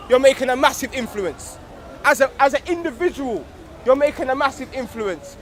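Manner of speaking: loud, rising address to the brothers; rally the room like a preacher; rousing speech. Acted. Young man addressing the circle, rousing loud speech.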